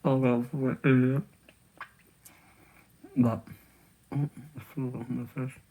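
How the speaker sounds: talking through a mouthful; chewing while talking; mouth full. Real, not acted. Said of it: "Probably shouldn't talk about food in my mouth".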